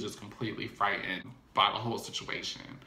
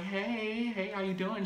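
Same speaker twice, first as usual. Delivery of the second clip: sweet greeting; 9 semitones higher, 4 dB quieter than usual. Retold or imitated. Amber greeting Cisco sweetly.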